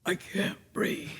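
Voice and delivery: whispered, gasping for air; out of breath at altitude, whispering; breathless whisper. Retold or imitated. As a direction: whisper it like the air is too thin. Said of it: "I stepped out of the car and went".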